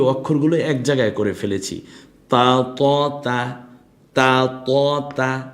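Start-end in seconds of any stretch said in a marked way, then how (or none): 2.3-5.4 তা and ত said firmly and loudly; louder drill. Shown as a demonstration. Teacher modelling letters for students to repeat. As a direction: bark out তা, ত to drill. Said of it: "এভাবে বলুন".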